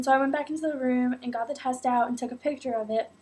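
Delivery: ordinary talk, nothing marked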